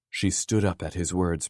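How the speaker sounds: ordinary delivery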